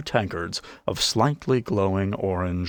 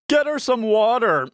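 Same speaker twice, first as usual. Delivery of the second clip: much higher and louder jeering call; calling over; 5 dB louder than usual. A narrator's reading. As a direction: shout it across the tavern to mock her. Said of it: "the heckler called over".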